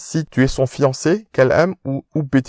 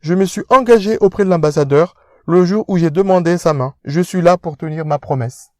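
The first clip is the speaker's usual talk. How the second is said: pompous rich fiancé, pitched up; posh fiancé; 8 semitones higher than usual. Acted. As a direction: speak down to him like an aristocrat.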